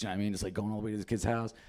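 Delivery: usual speaking voice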